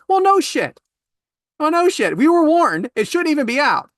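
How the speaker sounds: high, loud indignant comeback; worked-up, told-you-so ranting; exasperated outburst. Real, not acted. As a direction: burst out in comic outrage, pitch soaring. Ranting about the gravy.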